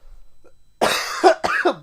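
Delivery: wheezing, airless laugh; a real wheezing laugh; breathless laugh. Real, not acted. Still laughing.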